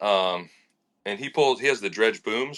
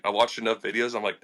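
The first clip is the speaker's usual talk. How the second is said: ordinary talk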